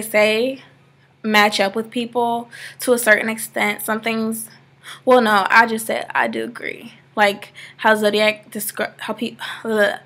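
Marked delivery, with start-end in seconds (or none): none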